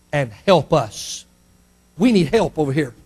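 low, softened plea; quiet appeal. Retold or imitated. Man of Macedonia to Paul, quiet appeal. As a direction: drop low and ask for help.

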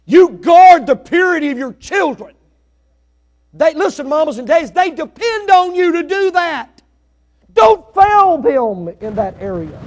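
shouted high plea, slowing down at the end; full-force preaching. Acted. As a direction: plead loudly with the mamas and dads.

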